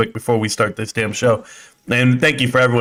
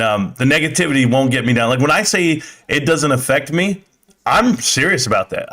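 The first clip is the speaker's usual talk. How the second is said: usual speaking voice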